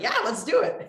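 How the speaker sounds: high, game yes; imitated eager agreement. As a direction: jump at the idea, acted out. Acts out eager reply to brother.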